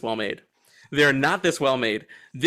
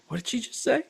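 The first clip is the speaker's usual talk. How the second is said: mock disbelief; 9 semitones higher, 4 dB quieter, faster than usual. Retelling his own confused reaction.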